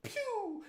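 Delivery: high-pitched whoosh of someone shooting off; high zooming "pew". Retold or imitated. Dad showing his daughter bolting from the chainsaw, high zoom. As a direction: imitate a cartoon getaway sound, high.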